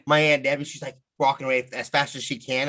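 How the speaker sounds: normal talking voice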